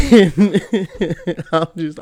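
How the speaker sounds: slow words through real laughter; laughing. Real, not acted. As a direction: laugh while starting the sentence. Laughing after his joke.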